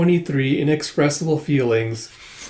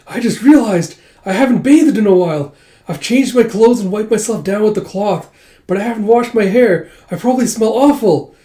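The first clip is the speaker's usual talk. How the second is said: high, louder, anxious blurting; flustered confession; 7 semitones higher, 5 dB louder than usual. Retold or imitated. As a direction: confess in mortified, pitched-up worry. Yona blurts out an embarrassed confession.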